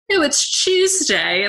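imitated British accent, high and drawn out; slow, high-pitched mock British. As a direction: put on a playful British accent. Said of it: "somebody speaking in a British accent".

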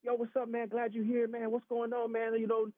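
imitated buddies, pitched high throughout; retold upbeat chatter; excited greeting. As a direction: welcome an old teammate back eagerly. Imitates old friends greeting him.

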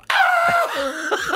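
re-enacted high-pitched scream through laughter; mock burn scream; high yelp of pain. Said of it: "So I just go".

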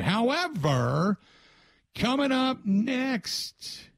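slow sing-song coming-up-next promo; radio tease. Acted. Radio host teasing next segment.

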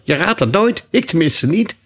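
high, loud impatient unicorn. A narrator's reading. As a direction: call it out impatiently, pitch well up. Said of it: "riep de eenhoorn ongeduldig".

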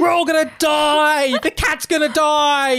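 high, slow mock panic; panicking alarm. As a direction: wail like a doomed alarm.

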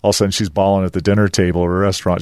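ordinary talk, nothing marked

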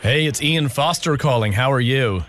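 put-on American phone greeting; American accent. Prank caller greets the store clerk, American accent. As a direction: lean on the R's in a fake American accent. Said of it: "talk to them in your American accent".

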